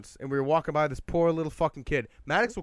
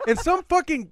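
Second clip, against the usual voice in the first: ordinary delivery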